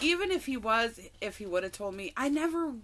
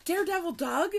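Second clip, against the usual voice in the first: higher and louder, can't believe it; incredulous disbelief; 7 semitones higher, 7 dB louder than usual. Real, not acted. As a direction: throw it out like you're stunned it's him. Disbelief at who texted.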